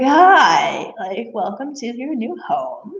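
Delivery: high, drawn-out greeting to a new horse; welcoming coo. Retold or imitated. Greeting the newly arrived horse, retold.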